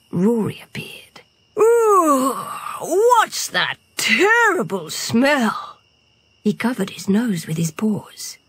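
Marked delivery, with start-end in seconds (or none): 1.6-5.8 high, slow, revolted groan of words; disgusted. A narrator's reading. Rory smells the sprayed boar, drawn-out disgust. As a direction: recoil from it, stretching each word. Lion reacts to stink.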